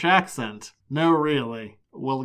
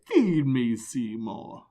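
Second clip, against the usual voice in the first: a lightly voiced plant monster demanding food; Audrey II; slower than usual. Quoting the flower with teeth in a mild monster voice. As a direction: quote the man-eating plant with a hint of menace. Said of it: "more of an Audrey two".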